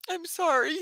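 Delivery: sighed, almost voiceless sorry; tearful whispered sorry; breathy tearful apology. Real, not acted. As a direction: sigh the apology through tears. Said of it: "hoping I wouldn't cry".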